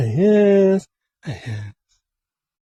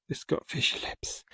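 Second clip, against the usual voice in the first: whisper; 16 dB quieter than usual. Pretend. Whispered aside on sculpture.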